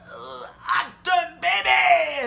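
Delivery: very high, slow, shouted wail; stoned lad panicking in a high wail. Retold or imitated. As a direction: cry out like you're falling apart.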